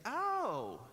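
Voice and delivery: high, loud, falling "oh"; mock surprised reaction. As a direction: imitate someone impressed and taken aback. People react to his native name.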